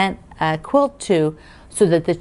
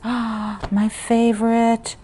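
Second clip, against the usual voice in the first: delighted gasp, then slow, higher words; real delight; 5 semitones higher, much slower than usual. Real, not acted. Quilter showing her favorite moon print, real delight. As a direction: gasp and savor it slowly.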